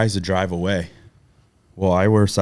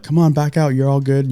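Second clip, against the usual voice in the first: raised, higher voice waving a car back; calling out; 7 semitones higher, 4 dB louder than usual. Retold or imitated. Directing the old ladies' car out, calling loudly. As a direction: call out over the traffic to guide them.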